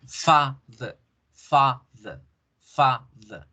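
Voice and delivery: "Father" as strong long FAAH, weak thuh; stress on first syllable. Shown as a demonstration. Teacher shows which part has force, stress on first syllable. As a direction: punch FA in "Father" and drop to schwa. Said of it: "es la que tiene fuerza".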